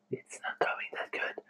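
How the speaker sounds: near-silent whispered aside; barely audible, whispered admission; hushed confession. Real, not acted. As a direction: whisper it like an embarrassing secret.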